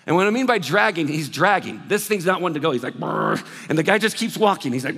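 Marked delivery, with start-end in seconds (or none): 3.0-3.4 low growl from the throat like a dog; a low rumbling brr; mock dog growl. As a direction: imitate the stubborn dog grumbling. Dog resisting on the leash.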